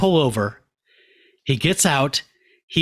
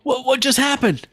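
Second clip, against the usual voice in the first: high, startled and confused; startled confusion; 10 semitones higher than usual. Retold or imitated. Rhino waking after the gunshot, high and startled. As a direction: jump up in pitch, baffled. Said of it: "He's like".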